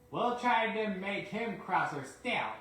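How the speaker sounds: plotting mischief with a snigger; spiteful snicker. Acted. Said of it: "snickered the spiteful brakeman".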